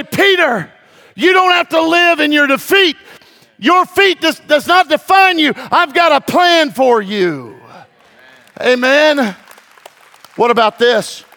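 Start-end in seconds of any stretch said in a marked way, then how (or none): 0.0-7.6 high, loud call to Peter; preacher shouting Jesus's words of restoration; loud preaching cry. Acted. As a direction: proclaim it with full force.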